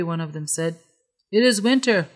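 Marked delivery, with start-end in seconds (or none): 1.3-2.1 louder, much higher angry reply; angry retort. A narrator's reading. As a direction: bite back at the begging magpies. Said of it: "Left Hand replied angrily".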